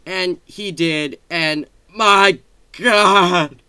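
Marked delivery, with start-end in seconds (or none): 1.3-3.6 very slow, loud, drawn-out amazement; awestruck. Real, not acted. Vlogger amazed by a gift from a modder, awestruck outburst. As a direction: stretch each word out in awe. Raving about an amazing gift.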